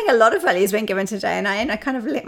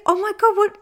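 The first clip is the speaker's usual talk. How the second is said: high, louder startled question; retold surprise; 12 semitones higher, 6 dB louder than usual. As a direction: caught off guard, high and loud. Mum hoping the cat returned.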